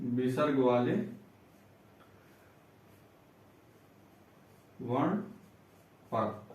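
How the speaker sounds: low, soft word-by-word dictation; quiet teacher spelling out a rule for note-takers. Shown as a demonstration.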